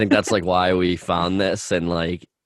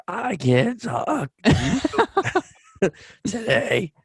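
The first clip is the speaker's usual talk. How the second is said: sick voice; 12 semitones higher, much slower than usual. Retold or imitated. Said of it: "I was literally like".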